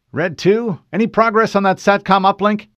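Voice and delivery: Fred's urgent call. Acted. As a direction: bark the question across the channel. Fred calls to Kelly.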